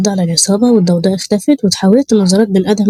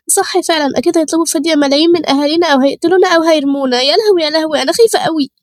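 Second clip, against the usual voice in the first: high, fearful wail of a captive; panicking girl; 8 semitones higher than usual. Acted. Captive girl fearing ransom or death, panicked and high. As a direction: fret aloud in a high scared voice.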